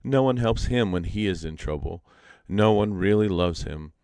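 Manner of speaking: usual speaking voice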